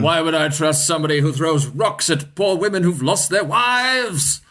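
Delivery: high, theatrical indignant paladin; paladin performing. Acted. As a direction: declaim it for the whole group to hear. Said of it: "perform it to the group. He's like".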